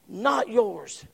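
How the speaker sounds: normal talking voice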